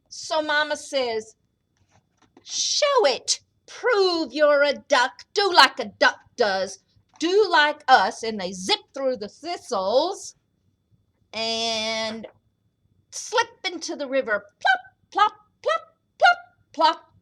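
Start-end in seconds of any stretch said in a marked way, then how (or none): 2.4-8.2 high sharp start, then loud challenge; demanding mama duck. Acted. 14.6-17.1 bright high-pitched plopping; high plops. Acted. Ducklings splash into river.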